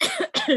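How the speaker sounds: small, deliberate throat clear. Acted. Shara clears her throat before a question, pointed little cough. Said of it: "she'll clear her throat a little bit".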